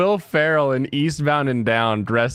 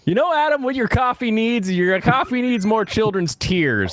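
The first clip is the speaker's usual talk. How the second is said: mock pitchman; 6 semitones higher than usual. Mocking pitch to Adam.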